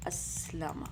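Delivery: اسلمہ as "as-la-ma", with a-vowels; اسلمہ carefully sounded as "ass-lama"; active form. Shown as a demonstration. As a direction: hold the s in اسلمہ. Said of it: "اسلمہ کا active ہمارے پاس کیا تھا".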